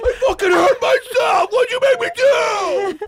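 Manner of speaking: high, loud, slow panicked shouting; freaked-out yell. Pretend. Man freaking out in bed, shouting slowly in mock panic. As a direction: shout it out in horrified confusion. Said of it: "freaked out".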